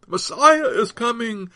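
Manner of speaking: high, loud announcing cry; shout. A narrator's reading. One in the crowd announcing the Messiah, shouting. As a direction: call it out to the whole crowd. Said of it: "shouted one".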